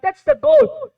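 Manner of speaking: That's the goal, rung out high and loud; emphatic punchline. Real, not acted. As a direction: lift the whole line up high and loud. Stressing the lesson's point. (malakas at mataas na diin)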